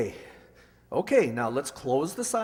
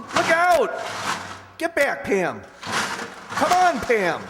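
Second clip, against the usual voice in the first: shouted warning; 7 semitones higher, 3 dB louder, much slower than usual. Acted. Bookcase falling on man.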